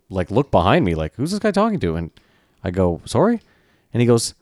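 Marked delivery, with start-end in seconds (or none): 1.2-2.0 higher-pitched puzzled question; retold bewilderment. Retelling his confusion at bus driver. 3.1-3.4 high, rising puzzled question; retold puzzled query. Retelling his puzzled reply to driver.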